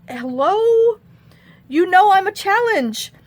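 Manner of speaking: high, drawn-out incredulous hello; sassy retort. Retold or imitated. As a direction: sass back in a high voice. Sassy reply to Ryan.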